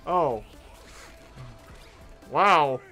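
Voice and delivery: ordinary delivery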